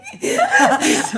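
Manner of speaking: squealing high real laugh; high burst of laughter. Real, not acted. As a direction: let a high laugh break out. Laughing at the memory.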